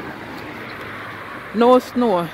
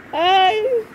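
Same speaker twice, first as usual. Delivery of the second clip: laughing call; 8 semitones higher than usual. Real, not acted. Laughing at the child.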